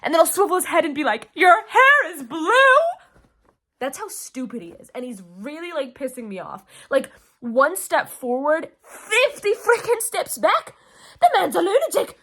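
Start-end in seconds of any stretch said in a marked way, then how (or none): 1.3-2.5 loud, high incredulous cry; hammed-up gullible shock, pitched up; mock astonishment. Reader mocking a prince who believes anything, loud astonishment. 8.8-12.2 high, loud, half-laughing outrage; exasperated ranting. Real, not acted. Fan fed up with the prince, exasperated outburst.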